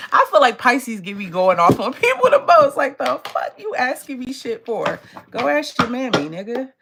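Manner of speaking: loud, high, going off on them; fired-up real rant; heated rant. Real, not acted. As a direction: tell them off, half laughing. Ranting about rude Pisces viewers.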